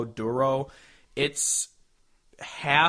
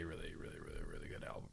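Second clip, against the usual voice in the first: fading whisper; 17 dB quieter, much faster than usual. Real, not acted. Host praising the EP, dropping to a fast whisper. Trailing rapid repeated really.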